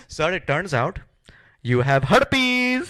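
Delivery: mock Indian doctor accent. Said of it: "Indians make the best doctors".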